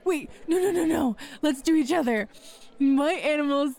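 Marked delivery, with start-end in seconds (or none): none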